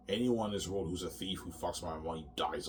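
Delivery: normal talking voice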